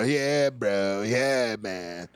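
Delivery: California dude-bro accent; caricatured American bro talk. Shown as a demonstration. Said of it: "Surfer bro".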